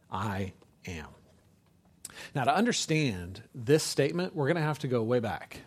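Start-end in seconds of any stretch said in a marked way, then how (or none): 0.1-1.1 low, near-whispered declaration; hushed solemn quote. Retold or imitated. Preacher quoting Jesus to the congregation, hushed and solemn. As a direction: drop to a hush, deep and grave.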